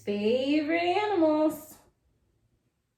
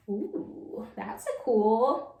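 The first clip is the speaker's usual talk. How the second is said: usual speaking voice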